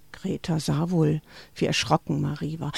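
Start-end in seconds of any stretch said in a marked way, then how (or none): none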